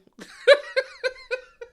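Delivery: sudden high, loud cackling laugh; a real laugh breaks out, up high; high burst of laughter. Real, not acted. Host laughing at Candace's go-go song, loud high cackle.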